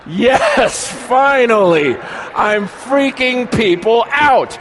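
loud, high, drawn-out cry of glee; hammed-up victorious shout; triumphant exulting. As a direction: crow it slowly and loudly. Exulting at freaking people out.

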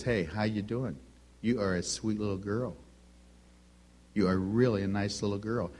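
put-on low, soft cooing to a baby girl; gentle hushed sweet talk; tender baby talk. Shown as a demonstration. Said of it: "when you change the tone of your voice".

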